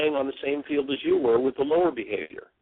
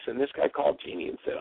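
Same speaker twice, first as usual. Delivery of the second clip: normal talking voice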